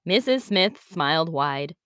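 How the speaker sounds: usual speaking voice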